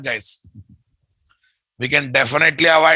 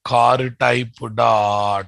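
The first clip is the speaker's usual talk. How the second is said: slow dictation; 3 semitones lower, much slower than usual. Shown as a demonstration. Dictating code slowly to class.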